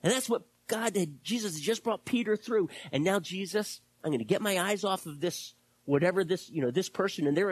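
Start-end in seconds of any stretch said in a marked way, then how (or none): none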